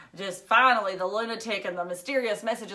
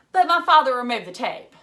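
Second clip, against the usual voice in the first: put-on louder, higher girlish protest; indignant girl, louder; 5 semitones higher, 11 dB louder than usual. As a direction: object loudly like a wronged kid. Phoebe protests.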